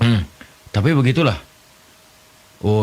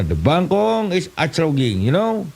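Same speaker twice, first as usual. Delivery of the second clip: much higher, slow mock English; broken English; 6 semitones higher, slower than usual. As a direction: ham up clumsy English. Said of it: "Bahasa Inggris tapi kepakojot". (bahasa Inggris ngawur, nada tinggi dibuat-buat)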